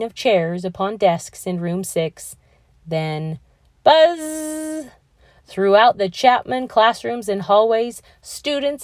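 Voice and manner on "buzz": bell buzz imitation. School bell rings.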